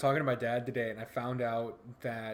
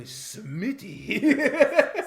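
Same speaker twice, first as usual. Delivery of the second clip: bad French accent, high and loud; 8 semitones higher, 9 dB louder than usual. Shown as a demonstration. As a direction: put on a hammed-up French accent. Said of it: "sound like with the French accent".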